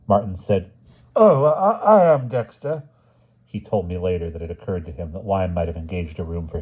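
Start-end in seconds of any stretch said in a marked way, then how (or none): 1.1-2.8 Martins voice. A narrator's reading. Martins voiced, high, slow.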